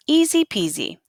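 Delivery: usual speaking voice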